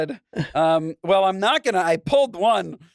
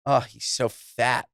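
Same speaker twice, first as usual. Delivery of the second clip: mean mock; 6 semitones lower, slower than usual. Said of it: "if this chinless Goyish woman was saying".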